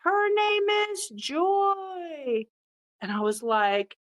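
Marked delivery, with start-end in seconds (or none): 0.0-2.5 high, slow called-out answer from across the room; a boy shouting the dog's name across the room, mildly mocked up; mild mock yell. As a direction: stretch it out like a kid calling back. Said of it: "And Carson yells back".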